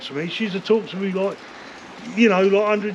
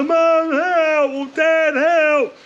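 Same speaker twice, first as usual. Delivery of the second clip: mock cry for help; 7 semitones higher, 3 dB louder, much slower than usual. Mock child calling for parents.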